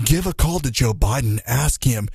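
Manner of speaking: a lightly voiced ominous ad narrator; sinister campaign ad voice. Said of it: "The sinister campaign ad voice".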